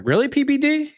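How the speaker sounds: pitched high in disbelief; can't believe he asked that; sarcastic, disbelieving jab at the host; incredulous. Real, not acted. Incredulous retort to host's question.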